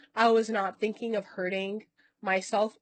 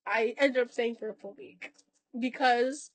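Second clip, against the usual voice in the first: ordinary talk, nothing marked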